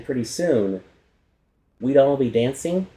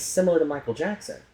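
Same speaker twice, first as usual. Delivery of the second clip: ordinary talk, nothing marked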